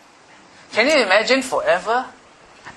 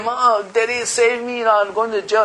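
put-on pleading child; 5 semitones higher than usual.

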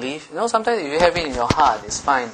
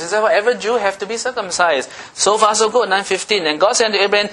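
usual speaking voice

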